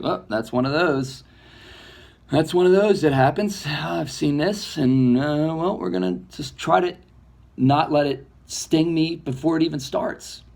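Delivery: lightly voiced unbothered self; knowing shrug. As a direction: brush it off like an old hand. Inner voice shrugging off betrayal.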